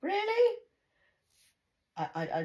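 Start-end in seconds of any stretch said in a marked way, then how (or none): 0.0-0.6 high, loud disbelief; really?; shocked at her words; incredulous. Retold or imitated. Incredulous retort.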